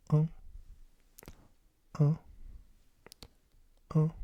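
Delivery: short nasal French "un", like a grunt; grunted French vowel. Shown as a demonstration. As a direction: say "un" as a brief wordless-sounding French grunt. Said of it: "you're kinda just grunting".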